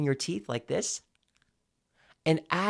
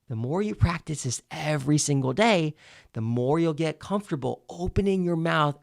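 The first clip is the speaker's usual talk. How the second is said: usual speaking voice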